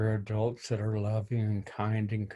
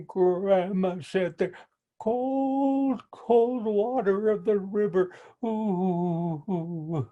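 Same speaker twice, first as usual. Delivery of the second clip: high, stammering fake fear with gasps and oohs; a turtle faking terror to trick him; mock-frightened little turtle; 9 semitones higher, slower than usual. Little turtle pretends the river scares her, high and stammering.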